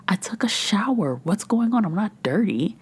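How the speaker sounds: high-pitched, bewildered self-quote; baffled protest. Retold or imitated. Podcaster reacting to crawly skin after showering, baffled protest. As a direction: pitch it up in mock exasperation.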